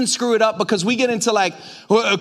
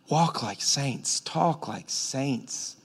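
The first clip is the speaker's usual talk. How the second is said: soft slow charge; 7 semitones lower, 9 dB quieter, much slower than usual. Acted. Paul's closing charge, slow and soft.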